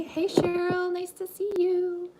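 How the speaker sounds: high, loud, delighted hello; light up and sing out the hello, drawing out the second half slowly; excited greeting. Real, not acted. Cooking host greeting a viewer who joined the livestream, high and delighted.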